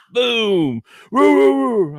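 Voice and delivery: high, loud woo woo of a barking dog; barking imitation. Rottweiler hitting the car glass, high loud barks. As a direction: bark it out like the Rottweiler.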